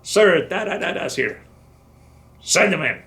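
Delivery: retold harsh clerk order. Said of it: "with a harsh voice".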